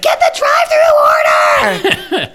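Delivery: mock manager's shout, very high and stretched out; hammed-up high, drawn-out yell. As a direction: holler like a fast-food manager, laugh in the voice. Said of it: "our manager said".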